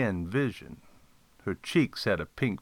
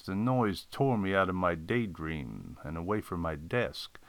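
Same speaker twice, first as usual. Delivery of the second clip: ordinary delivery, nothing marked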